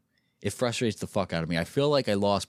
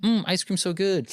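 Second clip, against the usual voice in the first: lightly imitated sing-song streamer catchphrase; a hint of cutesy NPC voice; 9 semitones higher than usual. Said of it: "these little animated mannerisms".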